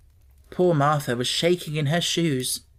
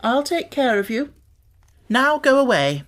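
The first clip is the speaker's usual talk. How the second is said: high, commanding little master; a sickly boy dismissing his maid; imperious boy; 9 semitones higher, 3 dB louder than usual. A narrator's reading. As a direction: send the maid off like a young lord. Colin dismissing Martha.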